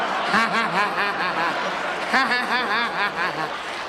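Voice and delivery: long, staccato mock laugh; put-on old-man chuckle. Drunk host amused by own joke, hammed-up laughing. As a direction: laugh in character as a tipsy elder.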